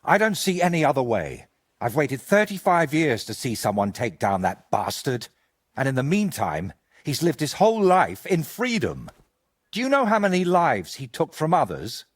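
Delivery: rising fury about the killer; bitter outburst. Acted. Friend rages to Henry about the murderer.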